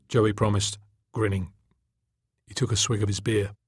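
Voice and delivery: ordinary delivery, nothing marked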